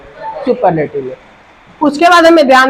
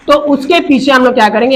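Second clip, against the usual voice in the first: ordinary talk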